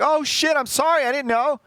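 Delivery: high, loud flustered apology; mock panicked apology. Shooter apologising to his friends, high flustered mock apology. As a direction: blurt sorry in a rush of guilt. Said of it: "And he's like".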